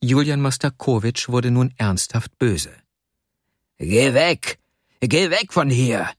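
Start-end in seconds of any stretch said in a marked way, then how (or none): 3.8-6.1 sharp, raised-pitch angry command; restrained narrated shout; angry yelling. A narrator's reading. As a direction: order the boy away in anger. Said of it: "schrie er den Kleinen an".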